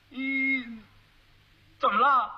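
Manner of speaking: drawn-out puzzled hum, then a question; a slow-witted groom caught off guard; puzzled fool. Acted. Fool reacts to bride's scolding.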